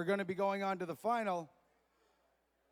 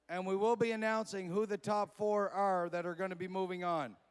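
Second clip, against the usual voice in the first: booming event announcer introductions; 4 dB louder than usual. Acted. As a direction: sell each name to the crowd. Wrap-up announcement.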